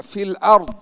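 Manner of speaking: "ফিল আরদ" high and loud, stressing the Arabic dad; teacher's emphatic dad in "আরদ"; careful dad sound. Shown as a demonstration. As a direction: hit the final dad of "আরদ". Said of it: "দদের উচ্চারণটা".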